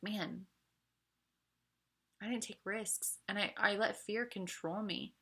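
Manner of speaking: softly rueful, with a long pause after "man"; regretful. Acted. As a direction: sigh it out softly like a life's regret. Imagined regretful old self looking back.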